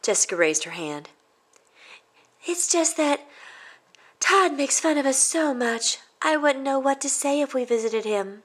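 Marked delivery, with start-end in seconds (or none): 2.4-8.4 put-on high, unsure kid's voice; hesitant girl. Jessica explaining to her teacher. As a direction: admit your worry.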